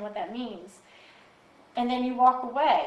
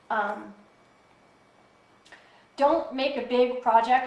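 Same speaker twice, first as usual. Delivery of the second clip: normal talking voice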